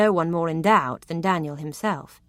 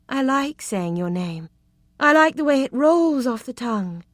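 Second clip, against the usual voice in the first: flirtatious; 7 semitones higher, 5 dB louder, slower than usual. A narrator's reading. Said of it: "She drew a deep breath after the first syllable".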